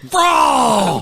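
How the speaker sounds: high, loud shouted bro; excited shout. Retold or imitated. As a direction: yell it out in awe. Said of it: "It was like".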